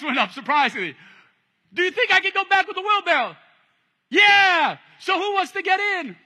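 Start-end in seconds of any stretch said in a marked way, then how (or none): 1.7-3.3 high, loud call to the crowd; the walker loudly asking the crowd who will climb in; calling out a challenge. Acted. 4.1-4.8 high, loud yelled yeah; the crowd shouting its yes to the walker. Acted. 5.0-6.2 high, loud call to the crowd; the walker loudly asking the crowd who will climb in; calling out a challenge. Acted.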